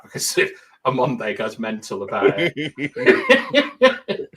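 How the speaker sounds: real laugh breaking through the words. Real, not acted. Joking to co-host about avoiding heavy topics, laughing. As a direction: say it through a loud giggle, slightly slowed. Laughs while making the remark.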